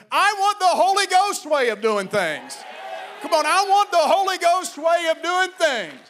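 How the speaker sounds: high, loud preacher's cry for the Holy Ghost way; shouted preaching. Acted. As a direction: preach it out at the top of your voice. Preaching at full force.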